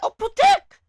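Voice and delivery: high, loud shriek of recognition; sudden squealed greeting; delighted surprise. Real, not acted. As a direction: shout it like spotting a long-lost friend. Spots an old viewer in chat.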